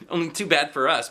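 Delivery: plain reading voice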